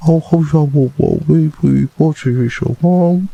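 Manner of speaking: put-on high cutesy baby talk. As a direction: coo it like talking to a baby. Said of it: "use the baby voice with you".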